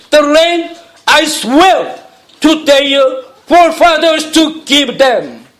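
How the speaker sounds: very slow, carefully paced English reading; slow English Bible reading to the congregation; scripture reading. Acted. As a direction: lay each phrase out slowly for the church. Reads verses in English.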